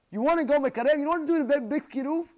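high, loud, driving sermon voice; impassioned preaching. Real, not acted. Preacher teaches how to draw people close, fervently. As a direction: preach it at full force. Preaches the lesson at full force.

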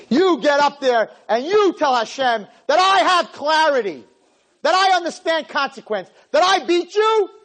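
loud, high, emphatic challenge; defiant command. Acted. Acted defiant order to Satan.

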